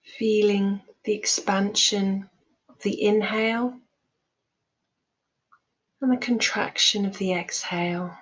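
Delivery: calm, measured meditation voice with long pauses; guided meditation. Real, not acted. Teacher leading listeners through loving kindness meditation, calm guided delivery. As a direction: guide them gently, leaving space after each phrase.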